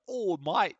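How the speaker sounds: a hint of a British accent on "Oh, mate"; lightly put-on British accent; mock British accent. Tidus imitated in a light British accent. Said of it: "doesn't actually have a British accent".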